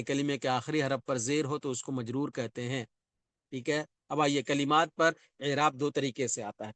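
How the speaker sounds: ordinary talk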